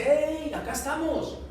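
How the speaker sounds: Paul calls out high to the guard, mildly; calling out. Retold or imitated. Paul calls to the guard: mild shout. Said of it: "Pablo le gritó".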